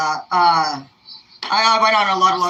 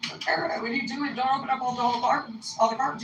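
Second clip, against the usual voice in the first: higher, softened voice of complaint; mock complaint; 5 semitones higher, 4 dB quieter than usual. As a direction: imitate someone objecting to what was done. Acted complaint about opened cartons.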